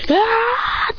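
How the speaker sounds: high-pitched battle cry; high "ah" of exertion; attack yell. Acted. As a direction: yell as the blow lands. Attack yell in a told fight.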